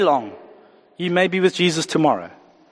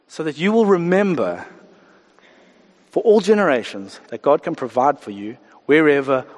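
normal talking voice

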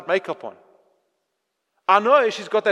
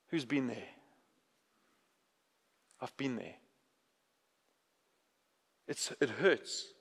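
much softer, lower, barely above a murmur; soft confession; 9 semitones lower, 15 dB quieter than usual. Real, not acted. Preacher confesses his own hurt to the congregation, very softly. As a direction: admit the pain gently.

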